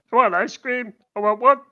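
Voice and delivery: a touch of a sketch-show impression; mildly put-on comic voice; lightly voiced Little Britain character. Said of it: "what's his name from, uh, what's it, Little Britain?".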